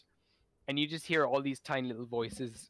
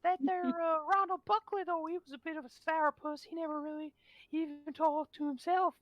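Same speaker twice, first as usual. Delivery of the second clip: louder, faster, swearing with delight; higher, giddy mushroom crowd; excited spore colony; 3 dB louder than usual. Acted. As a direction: spores thrilled to finally talk. Spores speaking.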